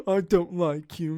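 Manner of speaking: slow, haughty tsundere brush-off, lightly voiced; mock a smug anime girl, drawn out; tsundere impression. Imitating Mithra's smug line to the player, slow and haughty. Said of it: "every time she's like".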